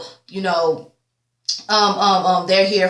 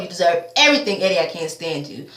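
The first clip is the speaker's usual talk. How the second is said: usual speaking voice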